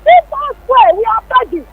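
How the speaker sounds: exasperated caller's appeal; impassioned plea. Real, not acted. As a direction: plead urgently, pitch climbing high. Caller pleading over fuel hardship.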